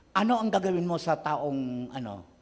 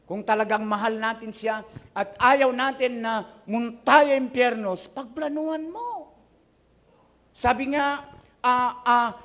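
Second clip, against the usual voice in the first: preaching; 9 semitones higher, 6 dB louder, slower than usual. Acted. Pastor urging congregation toward evangelism.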